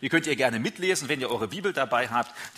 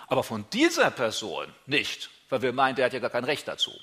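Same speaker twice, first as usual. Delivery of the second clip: ordinary talk, nothing marked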